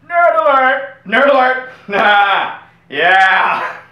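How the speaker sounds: high, loud alarm yell; nerd alert shout. Acted. A nerd hunter spotting a nerd, loud and high. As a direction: bellow it like a siren going off.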